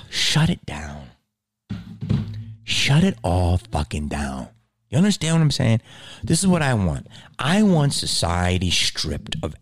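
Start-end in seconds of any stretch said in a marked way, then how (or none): 0.1-4.5 low grim decree. Acted. Ranting, low mock decree.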